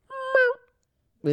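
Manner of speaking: very high, long imitated meow; high drawn-out meow; cat meow. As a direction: stretch out a thin cat cry.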